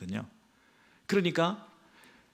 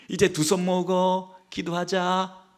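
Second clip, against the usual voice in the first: put-on teacher to children; 8 semitones higher, 3 dB louder, slower than usual. Like talking to Sunday-school children.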